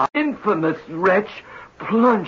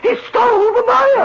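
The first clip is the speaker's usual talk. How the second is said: higher and louder anguished cry; outraged wail; 5 dB louder than usual. Acted. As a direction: repeat it as a wounded outcry. Designer repeats louder in outrage.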